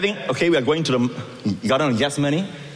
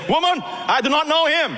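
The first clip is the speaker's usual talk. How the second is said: high, insistent denial; 13 semitones higher than usual. Acted. Peter denying Jesus.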